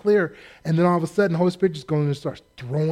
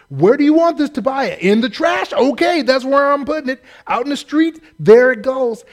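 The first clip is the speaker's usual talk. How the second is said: gleeful loud calling out; 7 semitones higher, 5 dB louder than usual. Pretend. Nehemiah throwing out Tobiah's things.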